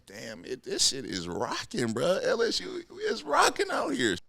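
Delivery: amazed at the roaring stadium, rising to a high shout at the end; starts hushed, then bursts up high; hyped awe. Retold or imitated. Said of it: "I was like".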